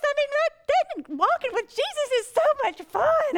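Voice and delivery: overjoyed exclamation; gushing joy. Real, not acted. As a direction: let the joy spill over, high and loud. Gushing about walking with Jesus.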